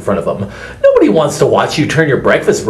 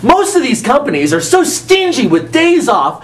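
excited rant; 11 semitones higher than usual. Acted. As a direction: rant it out, worked up. Ranting advice, shouting laughs.

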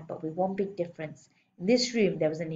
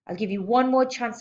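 menacing warning; 5 semitones higher, 5 dB louder than usual. Acted. Headmistress warns pupil after wrong answer.

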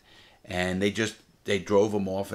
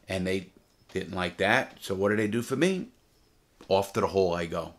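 ordinary delivery

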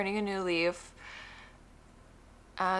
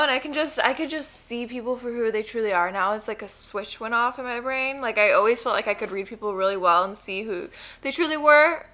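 ordinary delivery

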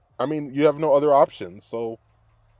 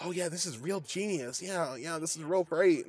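soft smug sarcastic voice, much quieter; mocking smug coach; 3 semitones higher, 8 dB quieter than usual. Mocking the coach's genius play call, soft and smug. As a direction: lightly voice a self-satisfied coach. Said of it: "McDaniel's like".

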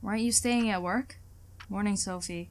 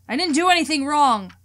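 defensive shout; 4 semitones higher, 7 dB louder than usual. Acted. Sophie defends herself, shouting.

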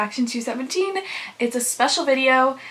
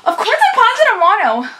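excited; 9 semitones higher, 5 dB louder than usual. Real, not acted. Excited over her bias.

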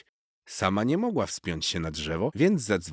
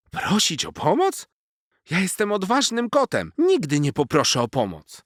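much higher, loud offended kitten; proud cat; 12 semitones higher, 7 dB louder than usual. A narrator's reading. Proud cat refusing to ask for help, high and loud. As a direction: refuse with wounded pride. Narrator voices cat Garfik.

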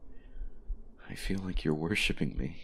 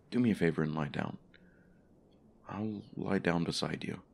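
normal talking voice

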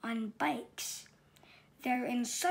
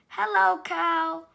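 loud, high, cheerful hello; cheery greeting; 5 semitones higher, 9 dB louder than usual. Acted. Duck greeting Cow while riding past, loud and cheerful. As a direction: call out happily from the bike. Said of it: "said Duck".

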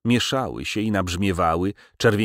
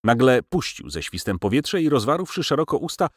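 ordinary delivery

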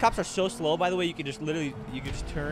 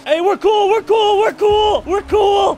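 high, loud frantic repeated plea; desperate shouting; 14 dB louder, much slower than usual. Real, not acted. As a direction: yell over and over to stop them shooting. Begging gang members not to shoot.

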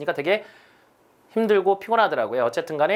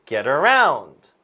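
slow demo, "around" stretched and punched; light "Get", long falling "around"; stress on "around"; 4 semitones lower, 4 dB louder, much slower than usual. Shown as a demonstration. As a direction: draw out and hit "around". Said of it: "뒤에 다시요".

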